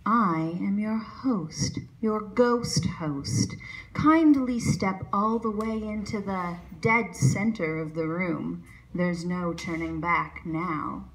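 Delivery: slow, eerie Haunted Mansion host; ghost host voice. Acted. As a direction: deliver it like a spooky ride narrator. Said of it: "Haunted Mansion humor".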